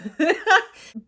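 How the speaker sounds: loud high laugh; loud burst of laughter. Real, not acted. Cracking up at her joke about Birmingham.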